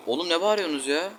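fed-up shout toward outside; exasperated yell. Real, not acted. Irritated gamer yelling at people screaming outside, real shout. Yells again at noise outside.

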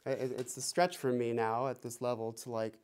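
normal talking voice

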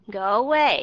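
emphatic go away, schwa still in place; stress on away. Shown as a demonstration. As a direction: hit away hard but keep the first vowel a short uh. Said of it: "Bạn đọc".

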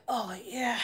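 put-on slow, soft, leering old man; lightly voiced creepy old man purring. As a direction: savor each word like a creepy client. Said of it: "He was like".

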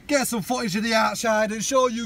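loud, hammed-up London accent, pitched high. Riffing with his mate on the video plan, mock London accent. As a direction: shout it in mock London geezer talk. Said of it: "You should put a London accent in".

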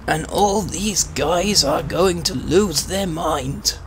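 put-on a doddery old Briton protesting politely; old man voice. Old man voice denying to the officer.

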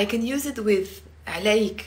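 normal talking voice

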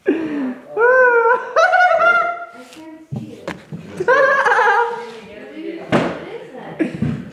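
long high giggling fit. Real, not acted. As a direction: dissolve into helpless giggles.

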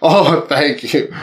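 ordinary talk

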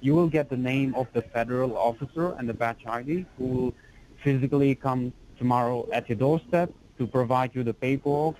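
ordinary delivery

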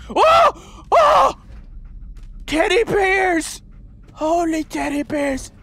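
amazed delight. Pretend. Player discovering a roomful of teddy bears, amazed delight. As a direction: exclaim in astonished joy.

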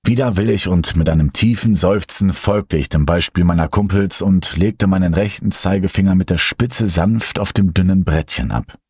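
plain reading voice

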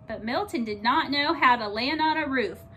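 plain reading voice

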